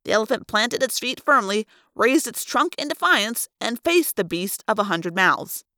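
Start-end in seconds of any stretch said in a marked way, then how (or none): none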